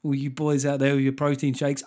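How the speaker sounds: usual speaking voice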